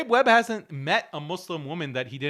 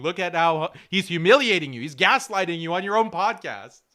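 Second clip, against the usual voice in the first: ordinary talk, nothing marked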